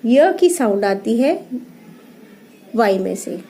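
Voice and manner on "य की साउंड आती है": ordinary talk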